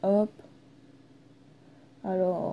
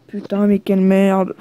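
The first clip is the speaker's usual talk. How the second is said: annoyed curse; 2 semitones lower, 7 dB louder than usual. Real, not acted. Fumbles the staircase in game.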